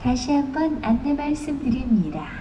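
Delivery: ordinary talk, nothing marked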